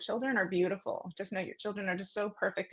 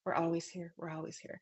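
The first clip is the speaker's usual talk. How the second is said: softer and softer with each repeat; fading soft repetition; 6 dB quieter than usual. Retold or imitated. Medium echoing the spirits, fading to a hush. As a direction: let each repeat fade away to nothing. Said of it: "That's what they keep repeating".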